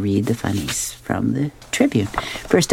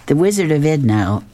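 ordinary delivery

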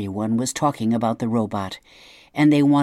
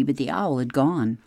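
ordinary delivery

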